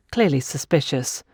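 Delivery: ordinary delivery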